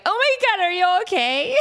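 high, loud, startled worry; suddenly panicked for a bandmate; high loud gasp of concern; alarmed concern. Retold or imitated. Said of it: "face switches from hardcore rocker".

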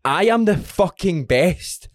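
slow and fired-up mantra; slow pumped mantra. Shown as a demonstration. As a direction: hammer each word slowly to fire yourself up. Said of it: "say to yourself".

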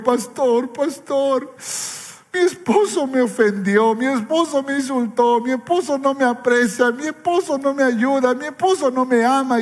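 a lightly played wife, crying out her complaints; tearful wife. Crying wife pleads to the pastor, mildly imitated. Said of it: "una esposa llorando me dijo".

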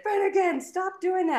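high, sharp scolding call; neighbor yelling. Retold or imitated. Neighbor scolding the son from outside, high yelling. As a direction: yell at the kid to stop. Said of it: "yell at him and be like".

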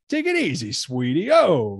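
put-on a light Brooklyn gangster accent. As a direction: imitate a Brooklyn mobster sweet-talking. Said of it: "that is a Brooklyn gangster asshole".

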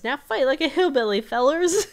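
put-on rally the fellers like a wild old hillbilly; hillbilly McGucket. Voicing McGucket rallying the group.

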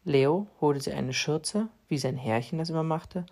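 ordinary delivery, nothing marked

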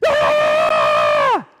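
loud panicked scream; loud anguished yell; child screaming. Retold or imitated. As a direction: burst in screaming that someone's hurt. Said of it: "will run in screaming".

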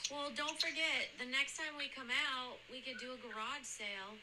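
tinny phone call audio; phone recording. Real, not acted. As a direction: sounds played back over a phone. Playing recorded call with Mom.

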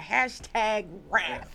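very slow, stretched-out catchphrase; drawn-out slogan. Pretend. Woman adopting her new favourite word, drawn-out slogan.

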